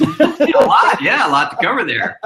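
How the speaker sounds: talking through a real laugh. Real, not acted. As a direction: laugh while answering, amused. Guest laughs at long intro.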